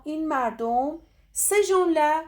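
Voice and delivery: ordinary talk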